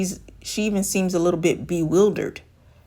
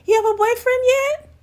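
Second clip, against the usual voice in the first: high, louder, prying question that rises on "yet"; nosy woman voice; 4 dB louder than usual. Retold or imitated. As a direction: pitch way up and pry again. Woman asks again months later.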